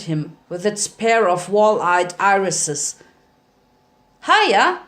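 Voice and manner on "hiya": high, louder chirpy hi; bright little-kid hello; chirpy greeting. A narrator's reading. Spike greeting the sewer reptile, high and chirpy.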